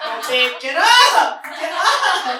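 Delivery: loud, slow, insistent call; calling to wake husband. Retold or imitated. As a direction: shout him awake. Waking snoring husband loudly.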